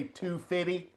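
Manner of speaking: FIFTY said "fiddy" in Southern style; Southern twang. Shown as a demonstration. Trader asking a colleague to translate his slang, Southern twang. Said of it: "give us a translation".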